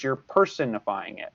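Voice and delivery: "personifying" said as PERSON-ifying, stress on the first syllable. Shown as a demonstration. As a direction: make "person" stand out inside "personifying". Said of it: "I'm emphasizing that because I want to emphasize the word person".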